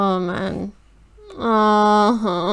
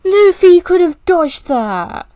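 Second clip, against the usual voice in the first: distressed protest; 8 semitones higher, 4 dB louder than usual. Real, not acted. Distressed complaint to screen.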